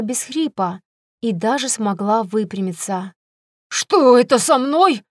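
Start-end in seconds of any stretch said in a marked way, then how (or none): 3.7-5.0 put-on confused, mumbling old woman question; bewildered old woman. A narrator's reading. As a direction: ask it lost and bewildered. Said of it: "растерянно прошамкала старуха".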